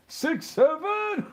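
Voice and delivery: high, slow laughing disbelief; mock disbelief. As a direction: stretch it out, high and incredulous, laughing. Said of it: "I'm like".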